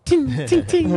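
high mock ting of clashing blades; sword clang noise. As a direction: ring it out like swords hitting. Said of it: "Sounds like a sword fight".

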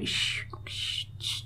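soft voiceless shushing, in short bursts; imitated shampoo hiss. Host imitating the shampoo noise she loves, hushed shushing. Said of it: "el ruidito del como el".

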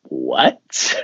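low, disbelieving what with a rise; a flat-out stunned question. Retold or imitated. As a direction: react in total disbelief. Stunned reaction to record news.

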